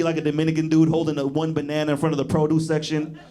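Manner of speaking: ordinary delivery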